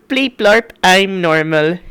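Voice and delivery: high beeping robot voice. Retold or imitated. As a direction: chirp it like a silly robot. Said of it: "I was just going".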